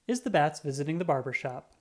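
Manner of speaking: usual speaking voice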